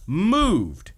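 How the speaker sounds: moved swoops high and falls; emphatic high repeat. Shown as a demonstration. Host stresses to co-host how moved the father was, swooping high. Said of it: "repeated word".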